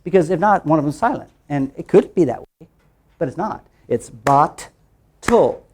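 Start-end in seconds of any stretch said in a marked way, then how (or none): 4.1-5.6 "bot-tle" very slow, a gap between the halves; separated syllables. Shown as a demonstration. Teacher showing two syllables to learners, slowly split apart. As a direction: break "bot-tle" into bot and tull, full T. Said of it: "Two syllables".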